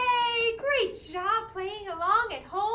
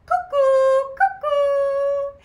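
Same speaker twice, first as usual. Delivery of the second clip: high, loud, hooting cuckoo; ringing two-note bird call; 8 semitones higher, 13 dB louder, much slower than usual. Shown as a demonstration. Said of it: "it says".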